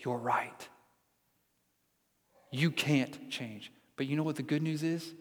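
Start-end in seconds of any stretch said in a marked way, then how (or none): 0.0-3.7 soft and slow, letting it sink in; gentle agreement. Real, not acted. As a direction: tell them kindly, quietly. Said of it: "To you, I would say".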